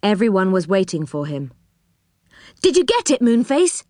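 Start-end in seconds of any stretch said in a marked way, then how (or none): 2.6-3.8 high, eager question; pitched-up expectant cry. A narrator's reading. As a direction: call out hopefully to a returning friend. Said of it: "they cried".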